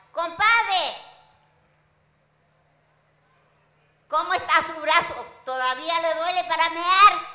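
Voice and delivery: loud shouted call across a distance. Acted. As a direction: holler it to a friend far off. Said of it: "me llama y me grita".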